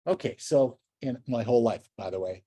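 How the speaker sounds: usual speaking voice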